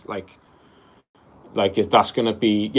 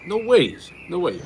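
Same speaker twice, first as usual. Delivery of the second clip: delighted disbelief, much higher; real surprise; 8 semitones higher than usual. Real, not acted. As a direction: genuinely caught off guard. Caller lives near his holiday spot.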